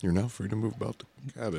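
airline announcement imitation; flight attendant voice. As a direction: cabin announcement. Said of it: "you just ripped off Southwest".

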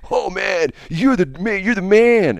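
imitating impressed young guys, high-pitched; mock cheer building to a loud peak. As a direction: hype him up like an awed buddy. Skateboarders cheer him in his story.

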